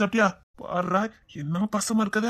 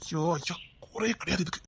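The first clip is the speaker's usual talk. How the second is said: low, much softer grumble; grumbling aside; 4 semitones lower, 11 dB quieter than usual. Acted. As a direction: grumble quietly to yourself. Grumbling about her under breath. (மெல்லிய குரலில் புலம்பல்)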